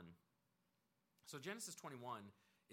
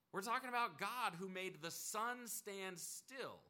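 preaching build; 6 semitones higher than usual. Real, not acted. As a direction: build the awe of God's power.

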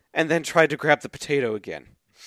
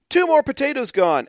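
higher and louder on the tally; mock alarm; 11 semitones higher, 5 dB louder than usual. Host reading a tweet aloud to her cohost, mock alarm. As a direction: play up the dwindling potatoes.

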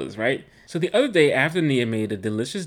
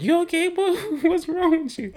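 mother's voice, pitched much higher; imitating mom; 3 dB louder than usual. Son imitates his mother asking if he's okay, high-pitched. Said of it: "my mother's like".

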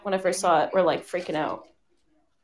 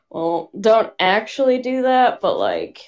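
normal talking voice

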